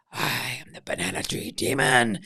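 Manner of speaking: low, slow spooky demon voice; slow, low hammed-up spirit boom. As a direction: loom over him like a tree monster. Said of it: "And I was like".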